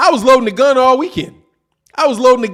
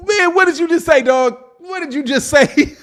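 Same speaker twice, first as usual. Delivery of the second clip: incredulous; 6 semitones higher than usual. Real, not acted. Shocked reaction to Fury quote.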